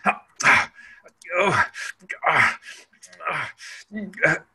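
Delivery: short choked gasps, each held and cut off; mock strained little breath holds; imitated breath-holding gasps. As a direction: gasp and catch the breath like a frustrated person holding it. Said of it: "they're going".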